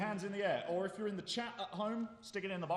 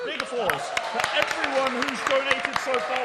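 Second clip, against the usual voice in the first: hyped announcer; 4 semitones higher, 11 dB louder than usual. Real, not acted. Host hyping charity applause.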